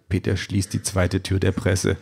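ordinary delivery, nothing marked

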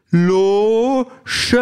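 "Loge" called out high; calling out. A narrator's reading. Sandra calls out "Loge" to the android, getting impatient. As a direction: call "Loge" across the counter. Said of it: "ruft Sandra".

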